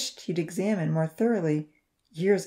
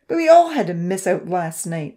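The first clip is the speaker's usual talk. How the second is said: growling; 8 dB louder than usual. A narrator's reading. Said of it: "Odin growled".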